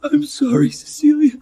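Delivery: tearful, voice cracking apology; breaking down crying. Acted. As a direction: cry through the apology. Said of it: "Alexander broke down".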